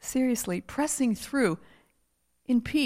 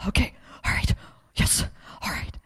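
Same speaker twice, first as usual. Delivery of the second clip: hushed, whispery compliance; meek giving in; 4 dB quieter than usual. Retold or imitated. Wife retells yielding to the word, meekly whispering. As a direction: whisper it as you give in.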